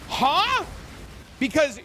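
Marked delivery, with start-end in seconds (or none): 0.1-0.6 shocked huh. Retold or imitated. Friends shocked by the reveal.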